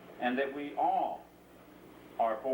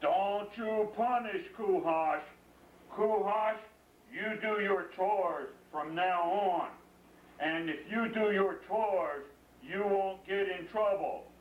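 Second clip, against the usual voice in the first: slow, higher, firm bear voice; lightly voiced bear laying down the rule; 6 semitones higher, slower than usual. Bear warns the father, then tells Kuhash to do chores, slow and firm. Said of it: "And the bear said".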